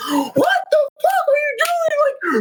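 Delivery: very high, drawn-out mock scream; imitated crazed yelling. Teammate heard screaming over an unmuted mic, crazed mock shriek. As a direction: screech it slowly as if losing it. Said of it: "he's just going crazy".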